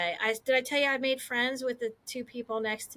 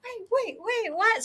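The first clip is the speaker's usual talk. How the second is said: high-pitched, confused double take; retold bewilderment; 9 semitones higher than usual. Retelling her surprise at the grand slam, bewildered. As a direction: pitch up as if caught off guard.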